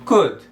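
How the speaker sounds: "could" with only a u sound in the middle; "could" as a model word, short u; correct pronunciation. Shown as a demonstration. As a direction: say "could" like "kud", L silent. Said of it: "como se tivesse só um U ali no meio".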